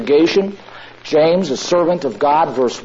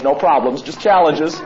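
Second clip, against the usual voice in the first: ordinary talk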